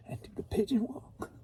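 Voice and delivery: whispered aside. Pretend. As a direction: whisper the silly bit. Whispered joke.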